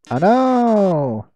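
drawn-out swooping wail; mock alarm. Villain gloating over the trap, stretched mock wail. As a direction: let the fake dismay rise and fall.

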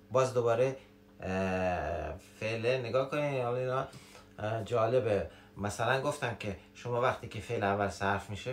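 normal talking voice

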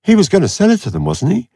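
high, fast, sly questioning voice; a thief fishing with a pointed little question; Villa, not quite innocent. A narrator's reading. Villa needling Blake about the dead man, high and sly. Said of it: "not quite innocently".

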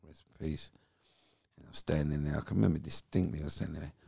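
ordinary talk

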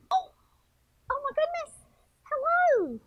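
high, startled oh my goodness and hello; surprised greeting. Real, not acted. Caught exercising by her class, she greets them in a startled high squeal. Caught mid-workout by viewers.

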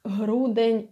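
грудень said "hruden" with a voiced h; soft voiced h. Shown as a demonstration. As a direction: breathe voice into the г of грудень. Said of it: "So compare".

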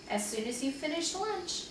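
plain reading voice

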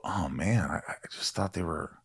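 hushed, unvoiced whisper. Host confiding about disappointing actors, hushed whisper. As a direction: whisper it like a guilty secret. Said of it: "people who you go".

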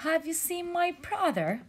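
high, hopeful question; high jellyfish asking. Acted. Jellyfish asks mermaid about lost brother, high hopeful. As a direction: ask it in a small high voice.

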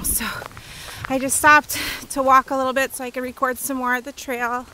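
normal talking voice

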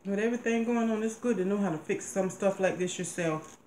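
speaking with some food in mouth; words between chewing; talking after a bite. Real, not acted. Seen 2: Woman took forkful at -1.0, speaks while chewing over plate.

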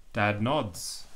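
ordinary delivery, nothing marked

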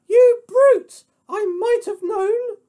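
very high, loud piggy falsetto; pig's outraged cry. Acted. Pig scolds the wolf, high outraged squeal. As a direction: squeal it at the brute. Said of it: "Pig cried".

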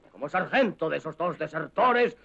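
ordinary talk

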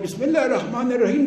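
fast, laughing recitation; mock lightning prayer. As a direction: rattle off the verse in a rush, mocking. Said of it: "نمازای برقی".

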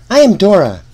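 plain reading voice

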